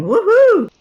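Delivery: high joyful whoop; excited cheer. Real, not acted. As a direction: cheer the win loudly. Celebrating the won fight.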